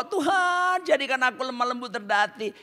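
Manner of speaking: high-pitched re-enacted plea to God; berdoa memohon. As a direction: plead upward in a mock-earnest prayer. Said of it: "Terus saya berdoa". (doa memohon dengan nada tinggi)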